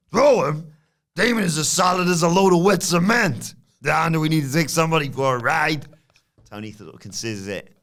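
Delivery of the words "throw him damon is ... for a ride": raised, louder tough-guy voice; lightly voiced mob heavy; Stack voice. Stack offering to take Damon for a ride, tough-guy voice. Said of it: "Stack says".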